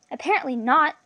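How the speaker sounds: mildly sour reply. A narrator's reading. Captive dashing her friend's hope, sourly. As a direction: answer with a touch of sourness. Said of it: "Miss replied sourly".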